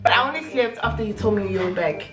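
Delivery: normal talking voice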